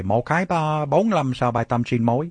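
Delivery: a quick burst of Vietnamese, set apart from the English; spoken Vietnamese. Shown as a demonstration. Preacher rattles off Vietnamese to the congregation, fluent Vietnamese. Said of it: "that actually is Vietnamese".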